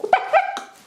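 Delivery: very high, squawked mock astonishment; shocked outburst. Fan told who scored the goals, squawking in disbelief. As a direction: let it burst out in hammed-up disbelief. Acted shocked reaction to player.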